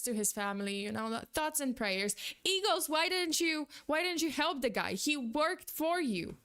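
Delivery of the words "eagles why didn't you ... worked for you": ordinary delivery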